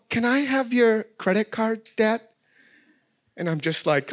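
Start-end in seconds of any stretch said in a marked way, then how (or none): 0.1-2.2 put-on sweet higher little-girl ask; little daughter voice. Daughter begging dad for his credit card, sweet. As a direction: ask like she already knows the answer.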